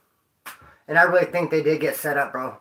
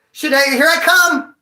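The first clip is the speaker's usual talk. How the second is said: mock eager; 7 semitones higher, 5 dB louder than usual. Eager to crawl out fast.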